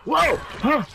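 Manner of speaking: sudden high yelp of surprise; startled, jump out of your skin; surprised whoa. Real, not acted.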